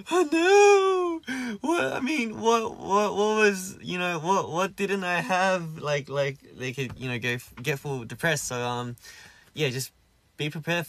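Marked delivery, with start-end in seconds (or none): 0.1-5.8 high, loud, crying mock wail of despair; distraught rejected person. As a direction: ham up a heartbroken cry, voice jumping high. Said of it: "there's people that are like".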